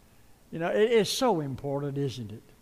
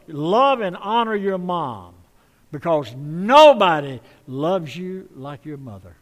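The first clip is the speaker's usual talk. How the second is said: slow and loud, pressing each word; slow, loud preaching; 8 dB louder than usual. Real, not acted. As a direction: lean on it like a preacher driving a point home. Preacher building to emphasis.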